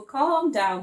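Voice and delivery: usual speaking voice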